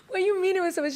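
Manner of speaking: high, indignant disbelief; high-pitched shocked question. Retold or imitated. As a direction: ask it stunned and hurt. Shocked reply to sister.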